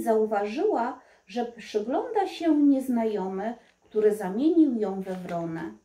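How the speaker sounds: ordinary delivery, nothing marked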